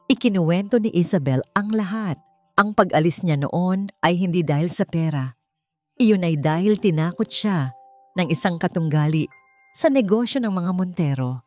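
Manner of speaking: plain reading voice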